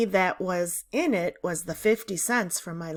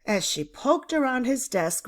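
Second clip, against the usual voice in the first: ordinary delivery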